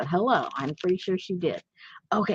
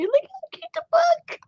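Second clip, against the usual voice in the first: very high, louder squeal; cute gushing; 4 dB louder than usual. Real, not acted. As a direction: squeal over something adorable. Gushing over tiny book.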